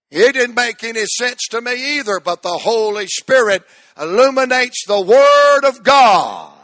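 rising from firm to a full shouted climax; building shout. Real, not acted.

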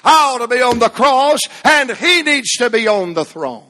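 loud, ringing pulpit cry; preaching at full force. Acted. Preacher calling the church to put God first, full force. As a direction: preach it out to the back pews.

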